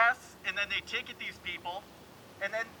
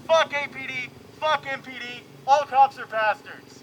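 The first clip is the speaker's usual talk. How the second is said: defiant shout; 3 semitones higher, 7 dB louder than usual. Real, not acted. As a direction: shout it at the crowd. Protest chant against police.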